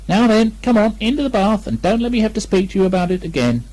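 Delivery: a touch of a stern Kanga; mildly severe mother. Acted. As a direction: tell the child off, not too hard. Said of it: "rather severely".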